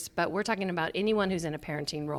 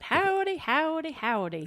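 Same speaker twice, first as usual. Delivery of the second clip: mock Gomer Pyle; 8 semitones higher than usual. Wife mocking husband's Gomer Pyle howdy, light twang. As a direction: lightly tease his country twang. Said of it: "you sounded a little bit like Gomer Pyle".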